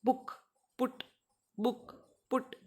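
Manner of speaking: बुक and पुट with a short u; isolated short-vowel examples बुक, पुट; short 'u' demo. Shown as a demonstration. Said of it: "छोटे ऊ को लिखते हैं".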